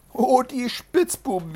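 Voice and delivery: mock indignation. A narrator's reading. Trickster pities the duped rider, exclaiming indignantly. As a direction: lament it with drawn-out sympathy. Trickster feigns outrage and pity.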